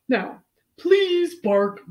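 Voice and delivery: loud, slow, drawn-out request; confident vet. Acted. Vet asking George to bark, slow and commanding. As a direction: coax the patient with grand authority.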